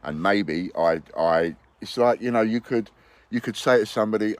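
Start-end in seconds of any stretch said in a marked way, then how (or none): none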